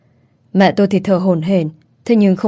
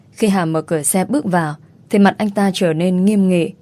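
ordinary delivery

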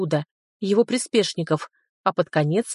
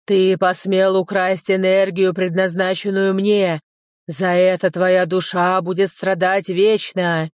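slow, loud, booming threat; menacing demon voice; 4 dB louder, slower than usual. Acted. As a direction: pronounce doom like a demon king. (грозный голос демона)

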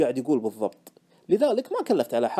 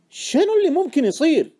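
high, loud demand; insistent question; 11 semitones higher, 6 dB louder than usual. Acted. Friend pressing the trader about his hunch, insistent. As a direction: press hard for an answer. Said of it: "سألني صاحبي بإصرار".

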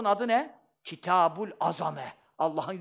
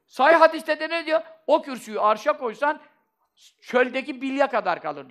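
raised, high-pitched sermon voice; full-force preaching; 8 semitones higher, 7 dB louder than usual. Real, not acted. Preacher citing hadith on the Throne's vastness, full-force preaching. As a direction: cite the proof with loud insistence.

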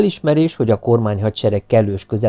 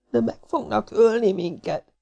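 high, thin lament; frightened wailing; 12 semitones higher, 4 dB quieter than usual. A narrator's reading. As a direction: whimper in panic, voice kept small. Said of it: "siránkozott most már hangosabban Mrs. Farmington".

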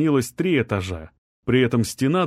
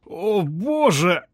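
slow, high drawn-out moan; husband moaning as the slab sticks; groaning; 7 semitones higher, much slower than usual. A narrator's reading. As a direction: moan it out in despair. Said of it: "застонал мужчина".

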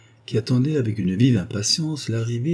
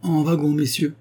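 calling out; 7 semitones higher than usual. A narrator's reading. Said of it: "cria un employé".

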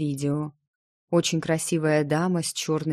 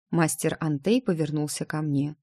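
ordinary delivery

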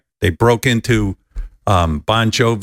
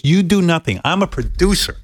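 lightly voiced bossy producer; 6 semitones higher than usual. Producer friend voiced in story.

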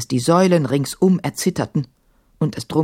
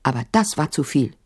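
usual speaking voice

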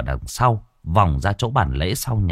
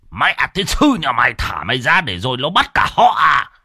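put-on louder, cursing old man's rebuke; angry old man; 5 semitones higher, 3 dB louder than usual. A narrator's reading. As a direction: curse him out for wanting to leave. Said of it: "Ông cụ Mốc cũng cau mày". (ông cụ chửi bực tức)

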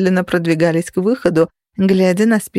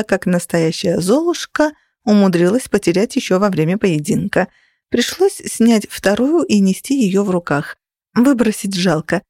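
ordinary delivery

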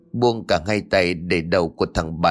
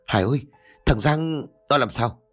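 on the verge of tears; 3 semitones higher than usual. A narrator's reading. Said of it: "ngữ điệu bây giờ đã trở nên mếu máo".

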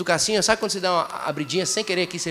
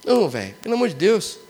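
ordinary talk

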